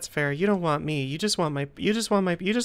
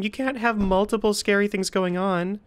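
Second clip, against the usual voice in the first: ordinary delivery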